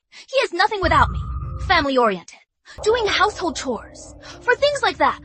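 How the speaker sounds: high, dismissive scorn; scornful. Acted. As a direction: brush him off with contempt. Daughter scorning her ex-husband.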